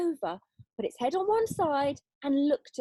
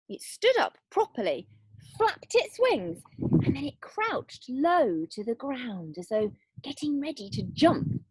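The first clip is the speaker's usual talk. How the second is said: ordinary delivery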